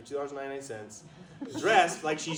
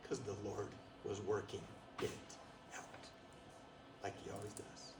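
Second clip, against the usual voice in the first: hushed reverence; 6 semitones lower, 15 dB quieter than usual. Real, not acted. Reverent aside.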